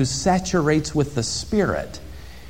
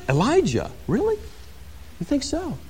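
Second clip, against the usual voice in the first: coy disbelief, fishing for more; mock flattered surprise; 11 semitones higher, 7 dB quieter than usual. Imagined John answering the delegation, flattered and coy. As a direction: act pleased yet modest. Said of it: "He could have answered".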